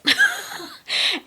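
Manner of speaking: high, bright laugh bursting out; burst of laughter. Real, not acted. Laughing while telling the podcast host about dad's last words. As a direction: laugh it off despite the sadness. Funny memory amid grief.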